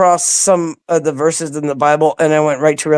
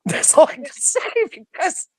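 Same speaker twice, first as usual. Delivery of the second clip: laughing; 9 semitones higher, 6 dB quieter than usual. Real, not acted. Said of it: "I'm sorry, I'm laughing".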